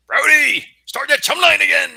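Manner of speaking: high, loud movie-captain voice; quoting Jaws. Retold or imitated. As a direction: bark it like a grizzled skipper. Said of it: "I would quote Jaws lines".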